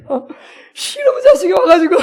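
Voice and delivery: high, loud, drawn-out mock fury; hammed-up father cursing his child; retold anger. Father blaming child for interrupting, hammed-up anger. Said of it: "너무 막 화가 나는 거예요".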